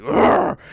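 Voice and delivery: loud rough mock growl; frustrated roar. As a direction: roar like the thwarted killer. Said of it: "He's like".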